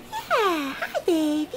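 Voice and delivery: ordinary talk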